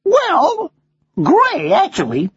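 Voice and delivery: high American parrot voice; American accent. Acted. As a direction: correct him in a high American bird voice. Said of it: "you have an American accent".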